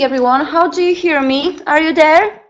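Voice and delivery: ordinary talk, nothing marked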